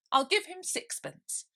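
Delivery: high, lightly voiced girl; indignant Alice. Alice protesting to the king, high and indignant.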